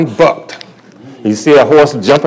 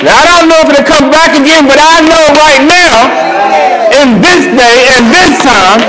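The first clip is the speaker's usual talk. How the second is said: fervent testimony; 13 semitones higher than usual. Real, not acted. Man testifying to listeners that Jesus healed his leukemia, preaching. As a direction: build to a shout of praise.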